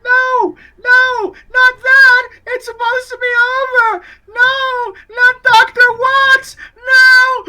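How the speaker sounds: put-on very high, loud, crying child screams; shrieking, tearful boy voice; terrified little boy. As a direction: scream each protest in childlike panic. Boy's panicked screaming begins.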